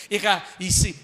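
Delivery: ordinary talk, nothing marked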